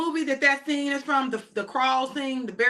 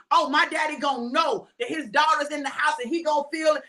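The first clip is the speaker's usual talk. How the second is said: ordinary delivery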